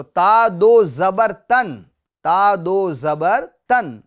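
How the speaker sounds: slow ता दो जबर तन with the alif left out; teacher demos the right spelling; correct spelling. Shown as a demonstration. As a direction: spell ता दो जबर तन slowly, skipping अलिफ. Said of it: "अलिफ का नाम हम नहीं लेंगे".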